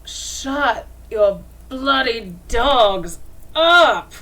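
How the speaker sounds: slow, loud, put-on woman's yell; furious camp woman shouting; angry woman yelling. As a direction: snap it out as a fed-up woman. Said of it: "the female voice just going".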